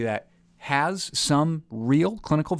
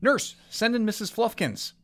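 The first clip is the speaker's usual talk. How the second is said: high, slow call down the hall; calling the nurse; 10 semitones higher than usual. Acted. Doctor calls the nurse through the door, high and slow. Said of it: "yelled out the door".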